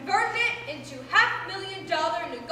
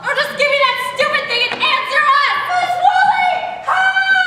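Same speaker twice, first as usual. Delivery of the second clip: fast high shouting, rising to a yell for help; angry demand into cries; 8 semitones higher, 10 dB louder, faster than usual. Acted. Grabbing at mother and calling Miss Woolley, frantic shouting. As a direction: snap loudly, then scream for rescue.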